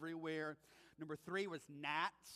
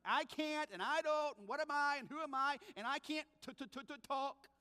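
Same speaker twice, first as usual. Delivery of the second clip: higher, pleading, stuttering on t-, t-, talk; stammering excuses; 7 semitones higher than usual. Retold or imitated. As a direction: Moses begging off, stumbling over talk. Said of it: "push back with some serious excuses".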